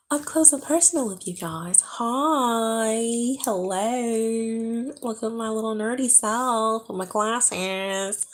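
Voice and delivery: playfully weird. Pretend. Vlogger clowning up close to her viewers, playfully weird. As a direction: draw out the greetings in a goofy high voice. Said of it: "I'm weird".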